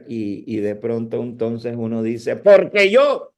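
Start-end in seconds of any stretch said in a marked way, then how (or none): 2.4-3.3 abrupt much higher, louder yell; startling preacher shout; sudden preacher outburst. Retold or imitated. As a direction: burst out mid-sermon without warning. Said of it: "de pronto tienen una elevación".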